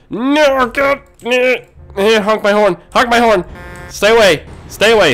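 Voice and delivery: high, loud warning shouts; panicked yelling. Real, not acted. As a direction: holler at traffic to keep clear. Panicked yelling at oncoming traffic.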